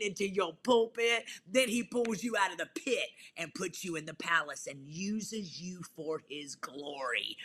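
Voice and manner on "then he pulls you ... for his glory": ordinary talk, nothing marked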